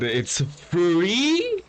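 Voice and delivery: ordinary delivery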